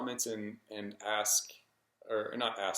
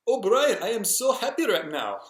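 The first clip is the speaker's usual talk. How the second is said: high, loud, delighted Indian-accented impression; happy older Indian gentleman, hammed-up accent; bad Indian accent; 11 semitones higher, 8 dB louder than usual. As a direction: butcher an Indian accent, pitched way up. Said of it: "the worst Indian accent".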